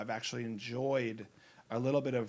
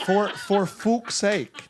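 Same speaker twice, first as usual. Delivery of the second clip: slow Irish "fuck's sake", louder with a laugh; Irish lilt, stretched out through a laugh; Irish accent, laughing; 6 semitones higher, 8 dB louder, much slower than usual. Shown as a demonstration. Actor repeats Irish trigger phrase, laughing in Irish accent. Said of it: "my trigger word is for Irish".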